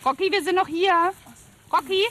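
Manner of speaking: high, sing-song call to a dog. Real, not acted. Calling out to a wandering dog.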